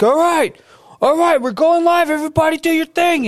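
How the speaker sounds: high-pitched announcer's call; calling out the start. Retold or imitated. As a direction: call the room to action, imitating an organiser. Drill organiser announcing the start.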